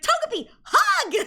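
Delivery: very high, slow, cutesy call; sweet command. Retold or imitated. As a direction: coo the command like baby talk. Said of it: "She's like".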